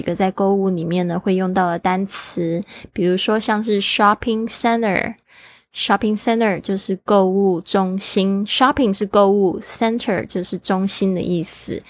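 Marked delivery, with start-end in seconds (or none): none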